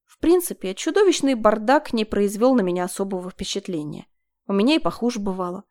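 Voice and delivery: ordinary delivery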